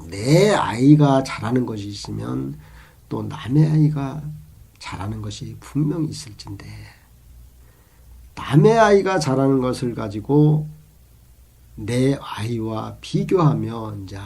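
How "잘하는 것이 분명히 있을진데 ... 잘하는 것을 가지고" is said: normal talking voice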